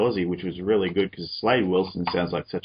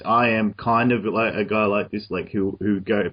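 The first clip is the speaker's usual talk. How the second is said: ordinary delivery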